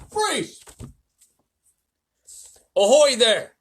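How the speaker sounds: very high, loud hail; police chief hailing the peach, shouting. Acted. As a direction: yell up at the roof. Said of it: "shouted the chief of police".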